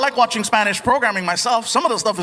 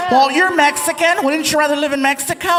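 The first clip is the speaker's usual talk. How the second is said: mocking questioner; 5 semitones higher, 3 dB louder than usual. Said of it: "Some people say".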